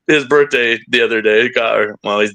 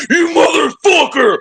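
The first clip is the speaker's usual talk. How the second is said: high, loud and slow, raging at his son; furious shout; 4 dB louder than usual. Retold or imitated. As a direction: imitate dad yelling in rage. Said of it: "He just turned to me and goes".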